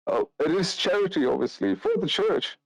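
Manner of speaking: very high-pitched priest voice; priest character. Acted. Priest explains his fee is church charity, high voice.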